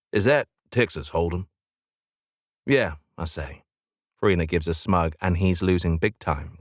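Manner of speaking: usual speaking voice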